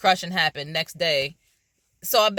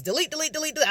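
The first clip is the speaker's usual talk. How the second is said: mock frantic deleting; 11 semitones higher, much faster than usual. Frantic deleting, acted self-quote.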